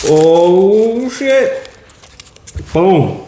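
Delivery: excited. Real, not acted. Pulling winner from the hat.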